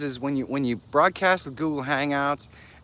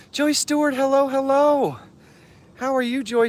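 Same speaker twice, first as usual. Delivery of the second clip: excited sing-song greeting; 13 semitones higher, 4 dB louder than usual. Real, not acted. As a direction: sing out the welcome. Greeting a viewer in chat.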